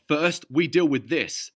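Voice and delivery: Ducky facing the undead; snarled. A narrator's reading. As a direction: grind out each word. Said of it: "Ducky snarled".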